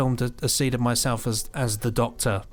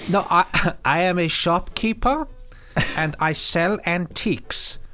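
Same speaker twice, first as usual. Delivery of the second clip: mock Hitler plea; 5 semitones higher, slower than usual. Hitler insisting he's a shopkeeper.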